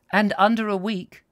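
a hint of a superior nurse topping the correction; Nurse Stedge. A narrator's reading.

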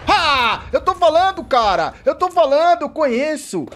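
loud, high I-told-you-so; real triumphant yelling at the stream; excited gloat. Real, not acted. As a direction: crow about being right. Gloating he predicted it.

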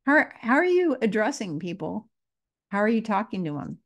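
normal talking voice

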